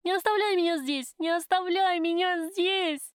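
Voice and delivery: very high, drawn-out wailing plea; frantic screaming. A narrator's reading. Prisoner dragged away from her lover, hysterical screaming. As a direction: wail it out in desperation, stretching the words. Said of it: "заходилась я в крике".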